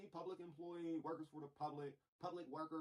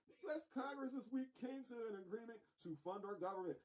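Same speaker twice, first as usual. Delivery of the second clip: mock Bernie reading; 8 semitones higher than usual. Mocking reading of Bernie's post.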